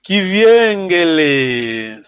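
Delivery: Kiviengele drawn out slowly, each syllable clear; slow model word. Shown as a demonstration. Teacher modelling Kiviengele slowly for the class. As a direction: spell out Kiviengele slowly by sound. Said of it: "teacher models the word slowly".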